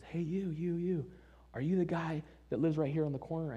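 high-pitched, eager calling; excited retold "is it you" hail; hailing someone. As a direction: imitate someone running up to flag you down. Man runs up, hailing him.

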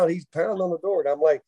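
ordinary delivery

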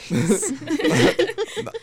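sudden high laugh; high burst of laughter. Real, not acted. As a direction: break into a loud laugh. Laughs at the oil baron joke.

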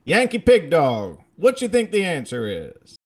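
high, loud Southern drawl; hammed-up Southern drawl; insane Southern drawl. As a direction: holler it like a Southern professor calling on a student. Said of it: "most insane drawl".

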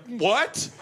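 rising high puzzled "What?"; confused. Retold or imitated. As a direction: act baffled by the billboard. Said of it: "I was like".

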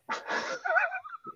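a soft, very high laugh; quick high giggle. Real, not acted. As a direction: let a little high laugh escape. Laughing at own joke.

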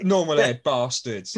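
usual speaking voice

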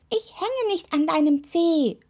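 high, indignant cry from a talking toe; indignant call. A narrator's reading. Toe correcting the witch, calling out indignantly. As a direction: protest it high and crossly. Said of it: "rief der Rausguckzeh".

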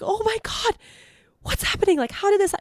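high, panicky shriek of alarm; panicked. Retold or imitated. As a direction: squeal it out in sudden alarm. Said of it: "And I'm like".

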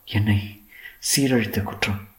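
hushed, halting admission; soft and hesitant, with small pauses. A narrator's reading. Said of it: "சற்று தயக்கத்துடன் மெல்ல சொன்னாள்".

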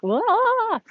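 high, loud burst of a wail; frustrated wail. Retold or imitated. Overwhelmed mom voicing her snapping point, loud high wail. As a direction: let it all out in one cry. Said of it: "finally I'm like".

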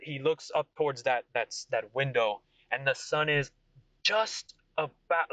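ordinary delivery

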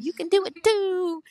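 high, loud cheesy cheerleader voice; hammed-up pep-talk voice; cheesy cheer. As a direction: sell it like a corny motivational poster. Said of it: "I know it's super cheesy".

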